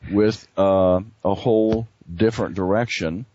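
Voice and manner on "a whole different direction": normal talking voice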